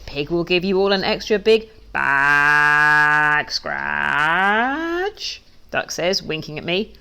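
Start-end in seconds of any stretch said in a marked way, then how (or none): 0.1-5.4 very slow, drawn-out bleating sheep voice; sheep speak. Acted. Duck bribes the sheep in slow bleating sheep talk. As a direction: stretch it like a sheep.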